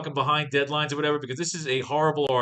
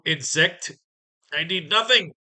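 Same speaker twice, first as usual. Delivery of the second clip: ranting villain; 4 semitones higher, 6 dB louder, slower than usual. Acted. Villain threatens hero in comic.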